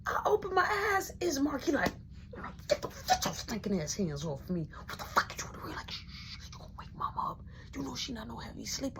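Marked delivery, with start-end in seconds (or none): 2.7-5.8 low, hushed, irritated protest at being woken; hushed angry protest. Retold or imitated. Woken at night by Mark. 5.9-9.0 breathy hushing whisper so mama stays asleep; urgent whisper. Retold or imitated. Not to wake mama.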